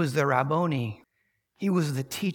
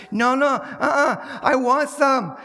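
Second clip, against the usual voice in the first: put-on much higher, louder toddler tantrum; 8 semitones higher, 7 dB louder than usual. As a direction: scream it like a three-year-old throwing a fit. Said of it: "this tantrum".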